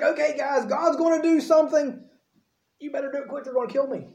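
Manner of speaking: nervous reassurance turning to a hushed plea; panicky Moses. Retold or imitated. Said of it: "He's like".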